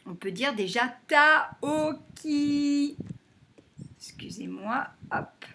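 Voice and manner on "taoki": Taoki drawn out as Ta-o-ki, higher; slow, chanted Ta-o-ki; syllable by syllable. Shown as a demonstration. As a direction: stretch each syllable of Taoki slowly. Teacher breaks word into syllables.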